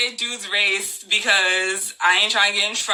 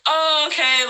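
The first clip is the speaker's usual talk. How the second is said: lightly voiced, higher-pitched stranger; imitated smiling stranger; 6 semitones higher, slower than usual. Woman retells creepy stranger's reply, lightly imitated smiling tone. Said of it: "he's just smiling. He's like".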